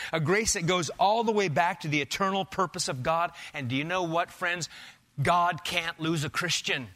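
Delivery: normal talking voice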